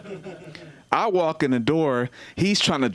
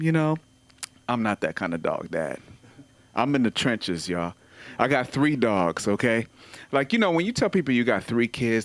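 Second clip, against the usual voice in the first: normal talking voice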